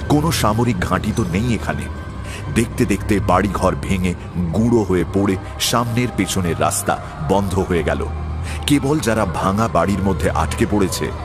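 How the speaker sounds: ordinary delivery, nothing marked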